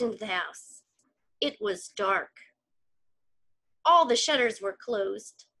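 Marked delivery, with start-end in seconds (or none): none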